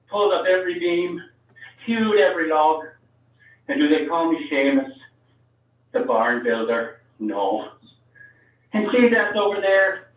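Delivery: put-on old Irish fella telling his grievance, rising to an outburst; full-on old Irishman's Irish accent. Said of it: "going all the way with the accent".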